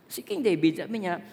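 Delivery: ordinary talk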